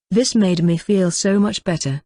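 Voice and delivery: usual speaking voice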